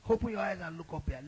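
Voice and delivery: ordinary talk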